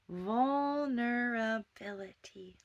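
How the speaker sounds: "Vulnerability" breathed slowly with a rise; slow whisper. Shown as a demonstration. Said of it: "I'll say it a little slower".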